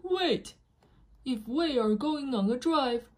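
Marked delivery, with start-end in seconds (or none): 0.0-0.6 sudden wait. Acted. Sudden thought while reading.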